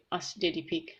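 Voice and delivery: usual speaking voice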